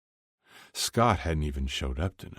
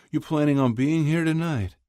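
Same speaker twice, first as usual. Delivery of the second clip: higher voice for Kip's dad, gently teasing; a dad ribbing his son at breakfast; father's voice; 12 semitones higher, 4 dB louder than usual. A narrator's reading. Dad teasing his son at breakfast, higher fatherly voice. Father asks about dinner.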